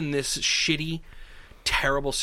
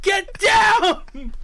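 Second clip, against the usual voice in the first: mock shout; 9 dB louder than usual. Staff shouting back at patient.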